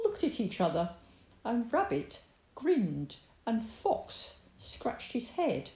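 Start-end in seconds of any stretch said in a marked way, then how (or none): none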